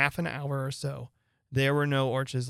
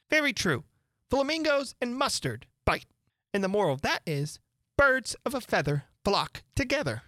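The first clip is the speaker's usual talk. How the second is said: lightly raised, preachy duchess; moralizing old noblewoman, sing-song; fussy Duchess voice; 9 semitones higher than usual. A narrator's reading. Duchess voiced in read story.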